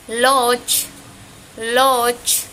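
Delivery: "loch" loud and drawn out, ending on CH; final Maya CH. Shown as a demonstration. Teacher showing CH word-finally, "loch" sounded out loudly. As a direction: stretch the vowel of "loch", finish crisply on CH. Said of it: "Y suena diferente".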